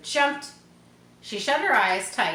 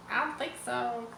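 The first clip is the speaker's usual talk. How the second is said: usual speaking voice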